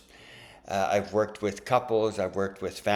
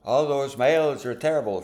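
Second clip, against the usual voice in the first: higher, louder imitated generalisation; mock sweeping complaint; 6 semitones higher, 7 dB louder than usual. Voicing someone who can't trust the other guy, mock complaint. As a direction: imitate someone writing off a whole group.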